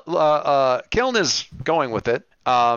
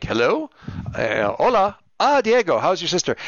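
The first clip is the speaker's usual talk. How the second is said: put-on Spanish accent, phone greeting, higher on "Ah, Diego"; Spanish accent impression; 6 semitones higher than usual. Superhero from The Tick answering his phone, Spanish accent. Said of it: "that accent is what I use".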